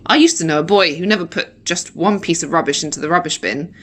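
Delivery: ordinary delivery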